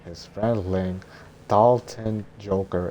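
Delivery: usual speaking voice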